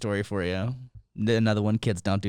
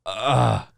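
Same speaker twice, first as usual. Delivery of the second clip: drawn-out "ugh" of disgust; exasperated groan; 2 semitones lower, 3 dB louder than usual. Real, not acted. Father-to-be imagining his kid misbehaving, exasperated groan. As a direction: groan at the very idea.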